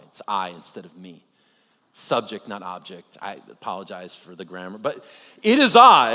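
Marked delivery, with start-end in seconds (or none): 5.4-6.1 It is I said loud and proud; emphatic formal quote. Shown as a demonstration. Preacher declaring Jesus' words to congregation, loud and formal. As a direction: announce It is I loudly, like royalty. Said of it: "It definitely sounds more British".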